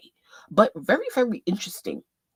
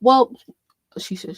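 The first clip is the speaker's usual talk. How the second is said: ordinary talk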